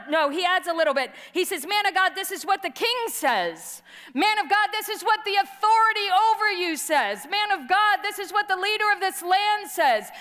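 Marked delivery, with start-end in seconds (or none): 1.7-10.1 high, insistent captain repeating his titles; pompous captain. Retold or imitated. Captain calling up the mountain to Elijah, insistent. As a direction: build the summons up, each Man of God pushed harder.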